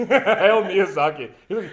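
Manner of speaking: loud laughing through the name. Real, not acted. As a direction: laugh while saying it. Laughing at the accent.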